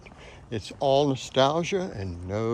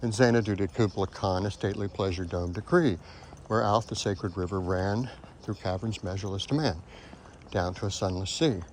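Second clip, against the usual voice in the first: measured, lower poetry reading; poem recitation; 4 semitones lower, 4 dB quieter than usual. Shown as a demonstration. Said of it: "I'll show you what I mean".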